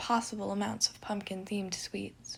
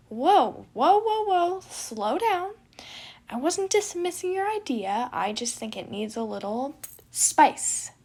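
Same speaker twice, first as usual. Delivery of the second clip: higher, louder, slowing the whoas; loud, higher gremlin twin; 6 semitones higher, 8 dB louder, slower than usual. Acted. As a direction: hold your brother back, loud and playful. Remus stops his brother.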